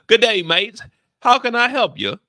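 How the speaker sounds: hammed-up British greeting, veering Irish; attempted UK accent. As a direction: belt out a bad British accent. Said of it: "sounds like it's about to be Irish".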